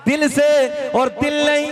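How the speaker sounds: drawn-out call. Shown as a demonstration. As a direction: stretch it out from the heart. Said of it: "ऐसे नहीं".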